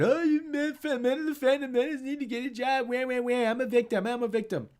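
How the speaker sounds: high, whiny mocking voice; mock whiny tweet reading. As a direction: whine it out as a self-pitying victim. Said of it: "I saw that tweet from Rachel Leischman. Said".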